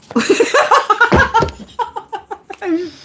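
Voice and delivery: long cackling laugh, pitched high. Real, not acted. Laughing at the card reading.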